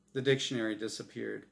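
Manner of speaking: usual speaking voice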